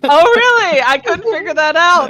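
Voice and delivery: high, loud mock surprise; exaggerated sarcastic reply. Real, not acted. Player answers a needless explanation, loudly sarcastic.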